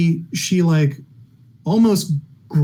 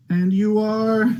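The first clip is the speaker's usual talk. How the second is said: slow, lightly higher clerk inquiry; prim clerk question; 4 semitones higher, slower than usual. Acted. Clerk turns to the second woman, slow and prim. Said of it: "the clerk looks over at you and goes".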